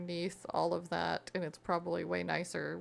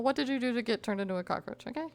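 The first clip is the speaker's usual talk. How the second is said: ordinary talk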